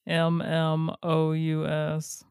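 slow letter-by-letter spelling. Shown as a demonstration. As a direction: spell each letter clearly. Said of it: "I was spelling it".

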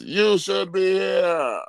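hammed-up promo shout. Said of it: "Someone else".

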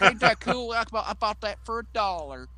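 a dad bragging about his ringtone; proud dad. Retold or imitated. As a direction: brag like a goofy dad. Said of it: "these dads who were like".

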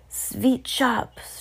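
put-on accented witch proclaiming "Sweet shops"; shouted announcement. A narrator's reading. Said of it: "shouted the Grand High Witch".

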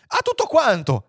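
high excited cry; joint exclamation. A narrator's reading. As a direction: exclaim it as if in chorus. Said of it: "esclamarono all'unisono". (grido entusiasta all'unisono)

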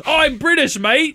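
high, hammed-up British accent; exaggerated British fan voice; super British. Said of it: "He's super British".

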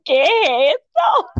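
very high, incredulous cry; shocked exclamation. Retold or imitated. Retelling seeing the live, high shocked shriek. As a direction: shriek the question in mock shock.

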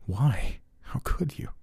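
breathless whispered disbelief; hurt whisper. A narrator's reading. Jack whispers at the villain in shock. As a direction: whisper it, stunned.

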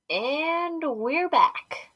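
usual speaking voice

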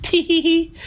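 very high mock squeal; flattered squeal. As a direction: squeak it like a flattered older lady.

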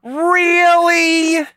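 very high, loud, drawn-out mock disbelief; exaggerated sarcasm. Podcaster escalating at co-host's sarcasm complaint, heavily sarcastic. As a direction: stretch it out in a squeal of fake surprise. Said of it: "being actually sarcastic".

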